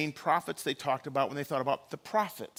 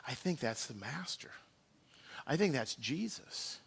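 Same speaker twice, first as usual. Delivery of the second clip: softly, half to himself; hushed realisation; 3 semitones lower, 8 dB quieter than usual. Acted. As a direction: say it low as it dawns on you. John's dawning recognition, retold.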